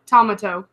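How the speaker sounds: "Tomato" as TOM-uh-toe, low; imitated mispronunciation. Quoting a retail customer, "Tomato" said TOM-uh-toe. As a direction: stress the first syllable of "Tomato", TOM-uh-toe. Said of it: "I heard someone once call a tomato".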